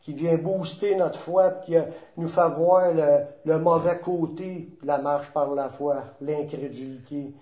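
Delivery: low, softened praying voice; prayer. Real, not acted. Leads the closing prayer. (prière recueillie, voix plus basse et adoucie)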